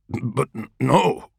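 tripping over the first word; stumble, then blurt the no; stammering. A narrator's reading. Flustered sergeant stammering a denial to his captain. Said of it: "Olborn stammers".

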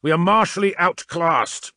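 loud, high, frustrated shout; frustrated roar. A narrator's reading. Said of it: "Kernan roared in frustration".

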